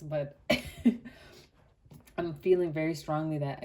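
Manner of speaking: normal talking voice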